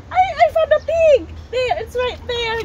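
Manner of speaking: high-pitched excited find; excited discovery. Real, not acted. Parent tells child the pig is found, high and excited. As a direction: exclaim it high, thrilled to spot it.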